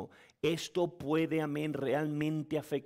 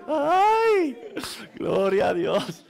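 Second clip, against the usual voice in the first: louder, laughing, slowed praise; laughing loudly; 11 semitones higher, 11 dB louder, much slower than usual. Real, not acted. Preacher still laughing, praising God, loud laughter. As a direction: gasp it out through laughter.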